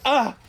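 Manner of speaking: short high yelp of pain; pained cry. Retold or imitated. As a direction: cry out like something hurt. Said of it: "Each hit is like".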